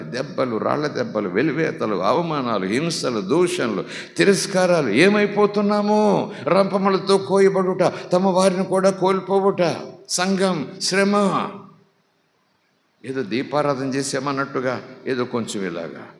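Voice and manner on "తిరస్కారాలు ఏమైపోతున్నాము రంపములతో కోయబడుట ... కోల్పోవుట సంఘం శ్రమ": loud, high preacher's cry with drawn-out endings; full-force preaching. Real, not acted. Preacher listing the church's true sufferings, full-force preaching. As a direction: preach it high and loud, stretching the last words.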